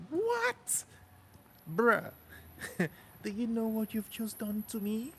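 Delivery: high, squeaky disbelief that rises into laughing; shocked, laughing gratitude at a big tip; stunned delight. Retold or imitated. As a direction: act floored by a twenty euro gift. Reacting to being handed twenty euros.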